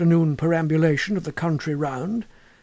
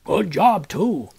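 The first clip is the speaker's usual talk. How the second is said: another villager; slower than usual. Acted. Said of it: "said a third".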